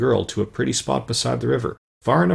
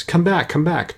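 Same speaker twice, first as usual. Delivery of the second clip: mild call, pitched higher; 6 semitones higher than usual. A narrator's reading. Tin Woodman to the fleeing mice, lightly calling out. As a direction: call them back without shouting. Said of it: "he called to the mice".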